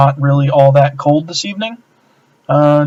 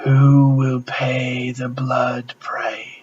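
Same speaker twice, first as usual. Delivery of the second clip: slow unvoiced hiss of a snake man; 7 dB quieter, much slower than usual. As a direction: breathe each word out as a slow menacing hiss. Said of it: "in a hissing voice".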